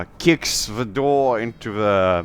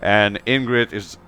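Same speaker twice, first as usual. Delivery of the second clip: ordinary delivery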